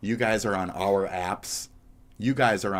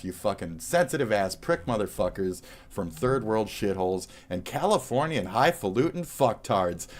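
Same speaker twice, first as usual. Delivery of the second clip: ordinary talk, nothing marked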